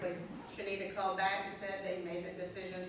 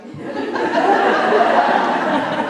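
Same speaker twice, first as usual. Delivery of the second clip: breathless burst of laughter; loud real laugh; 8 semitones higher, 21 dB louder than usual. Real, not acted. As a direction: laugh out freely. Laughing at own eagerness.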